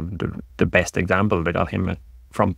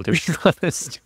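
laughing; 9 semitones higher than usual. Real, not acted. Laughing at terrible signings.

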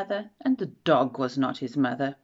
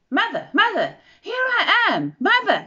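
eager calling; 7 dB louder than usual. Acted. Baby bird runs up calling.

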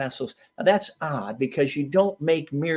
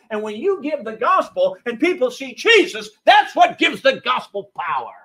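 rising, louder preaching build; 8 semitones higher, 6 dB louder than usual. Acted. Preacher driving home the point to the congregation, louder and higher. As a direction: preach it out with growing fire.

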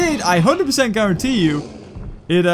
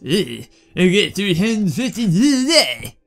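slow, hammed-up Japanese accent; geeky voice with mock-Japanese accent; bad mock Japanese accent; slower than usual. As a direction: put on a clumsy fake Japanese accent. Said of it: "doing a really racist Japanese accent".

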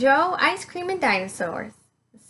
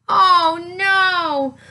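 higher, louder cry of alarm; 4 semitones higher, 4 dB louder than usual. Acted. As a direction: gasp it out in alarm. Huge dinosaur smashes in.

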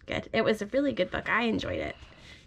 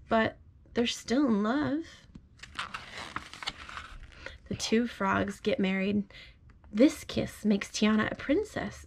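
gentle storybook read-aloud; reading to a child. Acted. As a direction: read it like a bedtime story to your little one. Parent reads picture book aloud.

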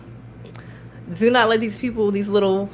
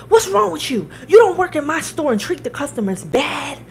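high, raised-voice scolding; retold furious telling-off, pitched way up; imitated screaming; 7 semitones higher, 4 dB louder than usual. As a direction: imitate a boss yelling at his employee. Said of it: "the owner screaming".

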